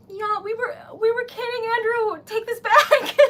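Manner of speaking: loud, high, laughing protest; overwhelmed joyful plea; excited laughing. Real, not acted.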